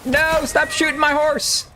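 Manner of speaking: high, slow, whiny plea; high whiny mock voice. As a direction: whine like a cartoon character. Pleading with enemy in silly voice.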